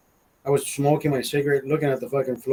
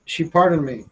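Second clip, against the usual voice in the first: normal talking voice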